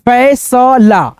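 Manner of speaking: फैसला very slowly split as फै, स, ला; drawn-out teaching version of फैसला; syllable-by-syllable reading. Shown as a demonstration. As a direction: sound out each piece of फैसला. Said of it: "कैसे उच्चारण करना है".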